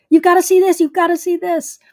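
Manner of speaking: high and loud, bursting with excitement; cheerleader excitement. Retold or imitated. Host imitating her own cheerleader mode over tomatoes, excited and loud. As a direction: cheer it like a pep squad leader. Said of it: "I'm like a cheerleader".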